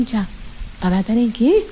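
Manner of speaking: usual speaking voice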